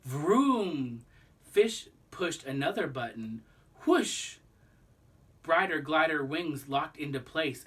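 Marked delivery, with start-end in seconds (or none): 0.0-1.0 a revving motor noise; a playful engine rev; engine vroom. Acted. 3.8-4.4 a high breathy rush of air; a quick airy whoosh; unvoiced whoosh. Glider wings locking.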